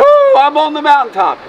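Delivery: high, whooping mountaintop joy; acted spiritual high; mock elation. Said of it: "You hear a great song".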